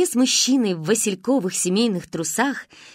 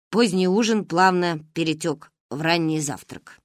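ordinary delivery

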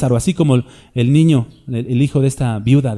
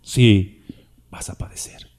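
hushed whisper meant to scare; frightening the congregation with a whispered threat; spooky whisper; 5 semitones lower, slower than usual. Shown as a demonstration. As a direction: whisper it like a ghost story. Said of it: "me gusta como que espantarlos".

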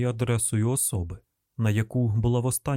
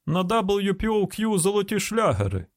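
high radio-jingle greeting; radio announcer answering the phone; radio slogan; 9 semitones higher than usual. Acted. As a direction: sing out the station slogan, pitched well up.

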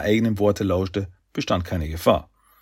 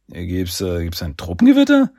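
plain reading voice

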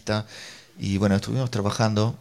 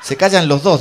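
loud, raised-pitch mock command; exasperated order; 5 semitones higher, 9 dB louder than usual. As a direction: order the quarrellers to stop, in imitation. Otro espectador interviene en riña. (orden exasperada en voz alta)

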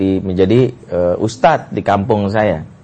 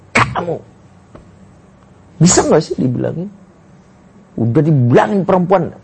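higher-pitched exasperated telling-off; retold angry scolding; 6 semitones higher than usual. Angry brother confronts his sister home late, retold scolding. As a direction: re-enact snapping at a younger sister. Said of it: "masuk rumah saya udah bentak dia".

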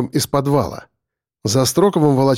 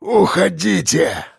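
slow, drawn-out croak, higher; hoarse rasp; 4 semitones higher, slower than usual. A narrator's reading. Fallen man urging rescuers away, hoarse rasp. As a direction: rasp it out slowly, insisting. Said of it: "прохрипел он".

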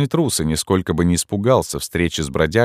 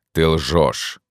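accusing. A narrator's reading. Ghost accuses.

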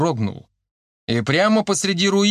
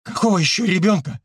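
higher, mildly frantic question; bewildered demand; 6 semitones higher than usual. A narrator's reading. Yurka pestering the analyst, bewildered. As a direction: ask it in rattled disbelief. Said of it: "Он отчаянно затеребил плечо аналитика".

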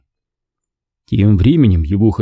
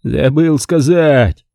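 higher, slow, patronising drawl; condescending; 6 semitones higher, much slower than usual. A narrator's reading. Stanton patronising his sceptical guest, condescending. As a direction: talk down to him. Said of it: "снисходительным тоном заявил Стентон".

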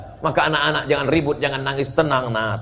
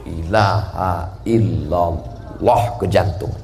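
slow dhikr; 6 semitones lower, much slower than usual. Shown as a demonstration. Demonstrating dhikr movement.

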